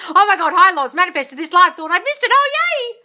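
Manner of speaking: high, loud gush rising to a squeal; excited fan voice. Retold or imitated. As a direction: read it as a thrilled latecomer. Reads an excited viewer's arrival comment.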